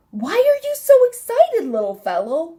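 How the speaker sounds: high, loud question settling on the last words; animated questioning. A narrator's reading. Miss Sparrow asks the jumping elf, high animated questioning. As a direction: pitch it way up, like a perky storybook bird.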